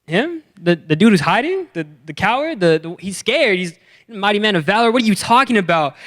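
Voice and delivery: high, disbelieving questioning; incredulous. Retold or imitated. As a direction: scoff in disbelief, rising high. Incredulous reaction to angel.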